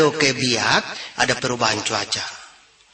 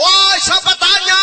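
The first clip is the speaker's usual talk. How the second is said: much higher, louder, slow shouting; slow comic shout; 9 dB louder, slower than usual. Acted. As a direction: drag out the yelled questions. Punchline shout.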